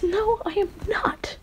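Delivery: high-pitched flustered protest; acted tsundere denial; frustrated denial. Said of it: "obviously frustrated and blushing".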